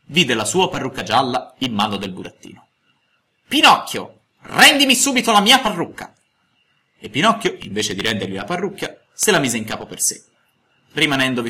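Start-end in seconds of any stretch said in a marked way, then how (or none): 3.5-6.1 much higher, louder outraged demand; indignant shout. A narrator's reading. Geppetto yells at Pinocchio to return his wig, much louder. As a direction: yell for the stolen wig back.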